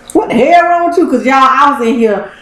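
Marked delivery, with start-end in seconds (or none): 0.1-1.1 higher, judging voice for the dog; puppy's look voiced. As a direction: voice the dog's puzzled stare. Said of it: "looking at me like".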